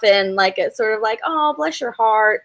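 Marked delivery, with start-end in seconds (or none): 1.3-2.4 high, mock-sweet bless your heart; condescending kindness, lightly put on; imitating a sweet southern put-down; southern brush-off. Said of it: "that's what we would say".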